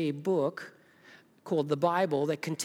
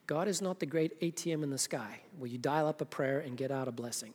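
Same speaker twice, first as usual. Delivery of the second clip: usual speaking voice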